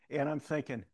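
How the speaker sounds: ordinary talk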